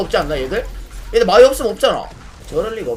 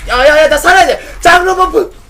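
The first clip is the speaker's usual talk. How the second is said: high, loud panicked yelling; urgent shout; 9 semitones higher, 10 dB louder than usual. Real, not acted. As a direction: yell to stay alive. Fighting to survive in game.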